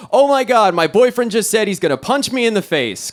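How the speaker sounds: high, urgent imitation of a frightened friend; mock panicked plea. Friend from LA calling for rescue, mock panic.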